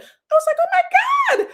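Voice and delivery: high mock shriek of shock; shocked squeal. Retold shock at reveal.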